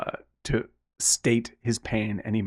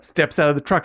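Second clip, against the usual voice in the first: normal talking voice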